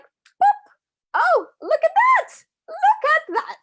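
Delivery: very high, loud squeal of delight; mock amazement. Coach discovering her supplements are ready, squeaky mock amazement. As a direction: squeak it in a tiny put-on voice.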